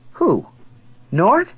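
high, loud startled question; annoyed surprise. Acted. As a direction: feign annoyance to hide confusion. She affects annoyance.

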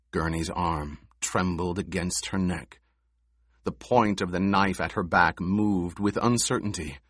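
ordinary delivery, nothing marked

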